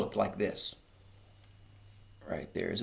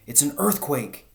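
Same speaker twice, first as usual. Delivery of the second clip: crying out; 6 semitones higher than usual. A narrator's reading. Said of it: "cried Missus Fox".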